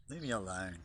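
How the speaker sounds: ordinary delivery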